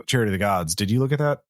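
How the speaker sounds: normal talking voice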